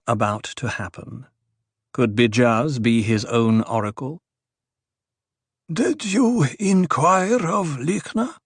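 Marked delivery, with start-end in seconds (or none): 5.7-8.4 put-on high, slow old man asking; sudden question. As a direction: ask it abruptly, old man voice. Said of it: "Othheim asked suddenly".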